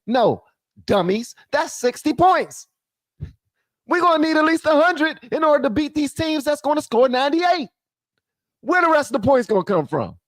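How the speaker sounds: high-pitched exasperated rant. Real, not acted. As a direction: tell the dummies off. Ranting at the Lakers.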